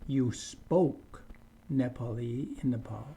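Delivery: ordinary talk, nothing marked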